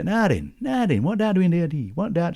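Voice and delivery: high, broad Sheffield voice; Sheffield accent. Shown as a demonstration. To show how Sheffield folk talk, a Sheffield accent. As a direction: put on a thick Sheffield accent. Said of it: "in Barnsley we talk like this. In Sheffield".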